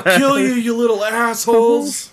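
high, loud mock yell; angry yell. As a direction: shout like a furious driver. Quoting angry driver.

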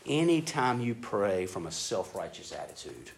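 ordinary talk, nothing marked

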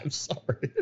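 whispered sorry. Real, not acted. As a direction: drop to a sheepish whisper. Apologizes after being told off.

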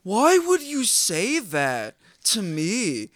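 high, slow, whining mimic of the guy; mock him as a dopey whiner; imitating the dumb coworker's whine; whiny coworker voice. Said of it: "this is literally how he talks".

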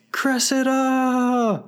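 high, slow, drawn-out wail; anguished yell. Retold or imitated. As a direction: howl her name to the sky. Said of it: "he actually yells".

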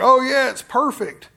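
mock sleazy salesman. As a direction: sell it with fake cheer. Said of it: "and he's like".

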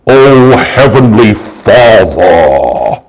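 low, slow, drawn-out pious prayer voice; mock religious prayer voice. Preacher mocking showy religious people, slow and low. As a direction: ham up a showy holy-sounding prayer. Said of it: "they can sound like they're really, really religious".

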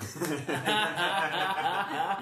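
loud burst of laughter; big laugh. Real, not acted. Friend laughing hard at a movie joke, big laugh.

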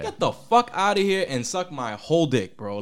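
ordinary talk, nothing marked

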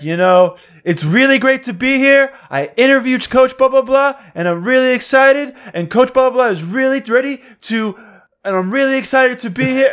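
high, loud, eager reporter repeating himself; imitating a nervous reporter. Mimicking the rookie reporter's repeated interview, high and loud. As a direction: ham up a flustered reporter looping the same lines. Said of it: "he's like".

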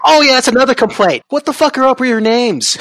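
high, loud, indignant outburst; exasperated complaint. Real, not acted. Host griping about the game's character names, exasperated and loud.

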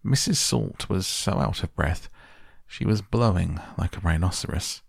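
ordinary delivery, nothing marked

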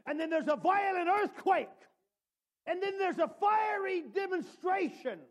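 full-force preaching build; preaching climax. Real, not acted. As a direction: climb to the peak of the storm.

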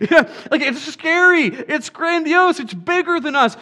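a preacher driving the point home to the church; preaching at full force. Real, not acted. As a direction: build it into a passionate climax.

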